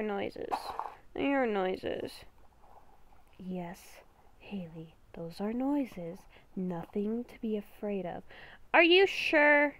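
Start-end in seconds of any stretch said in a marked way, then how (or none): 3.4-8.2 beast within voice. Acted. Inner beast whispering a warning to Haley, hushed and ominous. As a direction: whisper like a creature living inside her head.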